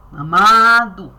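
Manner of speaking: loud, slow "amado"; drawn-out emphasis. Retold or imitated. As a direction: stretch the word out. (ênfase arrastada e alta)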